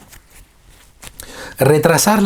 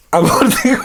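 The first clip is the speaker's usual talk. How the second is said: word collapsing into a much higher laugh; 13 semitones higher, 4 dB louder than usual. Real, not acted. Teacher repeating the funny word, bursting into laughter. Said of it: "Entonces, en Uruguay".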